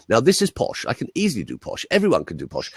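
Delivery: plummy posh English, tossed off easily; posh English accent. Shown as a demonstration. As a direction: demonstrate upper-class English. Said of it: "I can do posh if you want".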